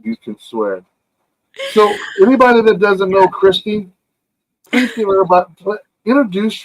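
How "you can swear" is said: slow, low, soft teasing consent; drawn-out indulgent tease; mock permission. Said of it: "You're mocking me".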